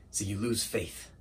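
very low, hushed delivery with long pauses; quiet resignation. Acted. Assistant giving up on his boss, quietly resigned. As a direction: give up on him softly.

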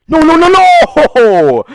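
high drawn-out screaming no. Real, not acted. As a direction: wail it out slowly, very high. Dying in game, screaming.

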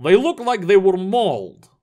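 matter-of-fact character voice; unruffled report in a put-on voice. A narrator's reading. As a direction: state it as a simple fact. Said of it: "Vladimir said matter-of-factly".

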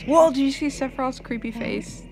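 normal talking voice

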